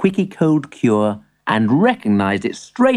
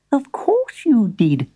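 high, slow tiny-creature voice; high storybook spider squeak; squeaky spider; 9 semitones higher than usual. A narrator's reading. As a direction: squeak support as a little spider. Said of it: "squeaked the spider".

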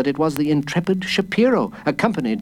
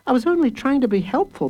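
protesting; 13 semitones higher, faster than usual. A narrator's reading. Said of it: "protested Jacob Tutu".

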